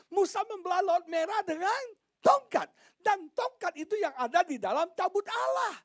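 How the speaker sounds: high, loud pulpit shout; full-force preaching. Real, not acted. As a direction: fire up the congregation, high and loud. (khotbah berapi-api dengan suara tinggi dan lantang)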